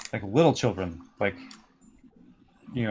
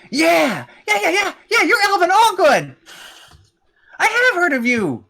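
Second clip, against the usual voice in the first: put-on very high, loud, gushing kid voice; thrilled little boy; 7 dB louder than usual. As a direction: shout like a small fan meeting a hero. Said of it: "then the little boy".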